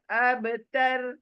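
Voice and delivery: ابتر drawn out slowly, stopped hard on the ر; ابتر as a slow, stopped word; stopping on abtar. Shown as a demonstration. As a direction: say ابتر slowly, halting on the final ر. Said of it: "لیکن میں stop کر رہی ہوں اس لیے".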